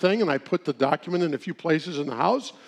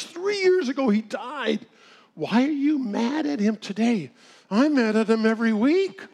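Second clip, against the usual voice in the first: imitated widow; 7 semitones higher than usual. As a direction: voice the widow, a little indignant. Widow answering pastor.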